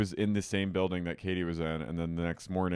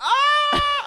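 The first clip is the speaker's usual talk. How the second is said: loud, very high squeal; mock scream; 10 dB louder than usual. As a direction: shriek as at a juicy reveal. Her own reaction to the reveal.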